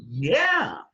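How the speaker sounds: emphatic quoted yes, high and loud; retold eager yes. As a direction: re-enact an eager acceptance. Retelling his yes to friend's offer.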